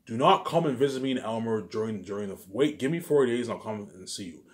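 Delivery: ordinary talk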